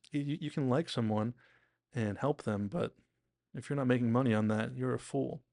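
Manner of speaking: normal talking voice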